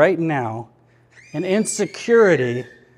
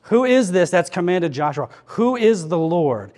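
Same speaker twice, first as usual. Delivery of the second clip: usual speaking voice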